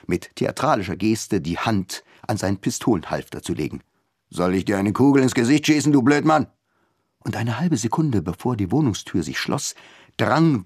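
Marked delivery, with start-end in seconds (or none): 4.3-6.5 threatening captain. A narrator's reading.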